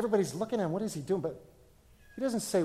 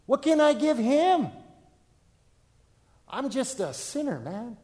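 higher, louder pleading questions; anxious believer; 6 semitones higher, 4 dB louder than usual. Retold or imitated. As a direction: plead as someone unworthy. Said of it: "phase two is this".

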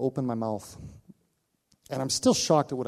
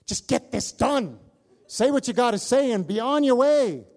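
put-on higher, louder, slowed impatient old man; grumpy brush-off; 10 semitones higher, 7 dB louder than usual. Dying man tells pastor to hurry, impatient and loud. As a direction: shoo the pastor away, fed up.

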